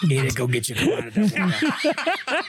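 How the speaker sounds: laughing mock Cajun voice; bayou accent. Player teasing friends as the gator man, laughing bayou accent. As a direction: bayou gator man cracking up mid-line. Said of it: "Bayou boy".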